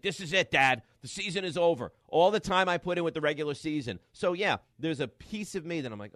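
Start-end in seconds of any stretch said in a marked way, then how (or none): 0.0-4.0 put-on higher and louder, an upset kid on the verge of tears; son's despair. Dad voicing his son's despair after the team lost, high and loud. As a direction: wail it like a ten-year-old whose season just ended. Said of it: "he's like".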